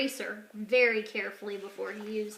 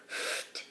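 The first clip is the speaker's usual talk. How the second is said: a quick unvoiced puff of air, ft; breathy ft sound; 11 dB quieter than usual. A narrator's reading. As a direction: blow out a soft ft. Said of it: "when you see quotation marks, say ft".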